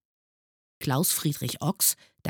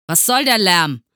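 loud, high, brusque demand; 6 semitones higher, 11 dB louder than usual. A narrator's reading. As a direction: demand it gruffly, like a cross teacher. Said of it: "fragte er barsch". (laut und barsch)

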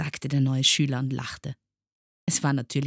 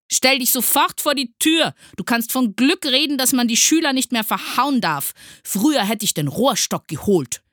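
loud, high teacher's tirade; furious yelling; 11 semitones higher, 8 dB louder than usual. A narrator's reading. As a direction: rage at the pupil. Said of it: "schrie Herr Studienrat Groll". (wütend schimpfend und schreiend)

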